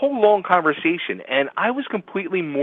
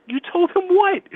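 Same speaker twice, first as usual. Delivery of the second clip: mock shocked, pitched way up; shocked disbelief. Host retelling his wife's confession, stunned disbelief. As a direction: replay the moment the news landed, stunned.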